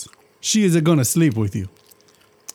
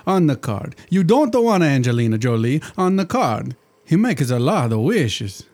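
put-on thick comic Italian genie accent; a jolly Italian genie, hammed-up accent; 3 semitones higher than usual. Genie chatting to his master in a hammed-up Italian accent.

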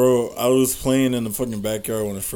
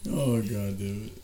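ordinary talk, nothing marked

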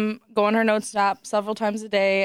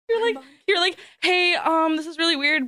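higher, breathless mock of her friend on the phone; imitating flustered friend; 7 semitones higher than usual. Friend retelling a call from her best friend, imitating her higher and flustered. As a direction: play the friend blurting out strange news, pitched up. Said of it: "And then she's like".

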